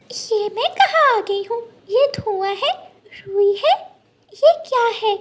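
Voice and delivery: put-on high, anxious child voice; a small child frightened and confused; lost little bird. Gudiya lost among the clouds, high anxious child voice.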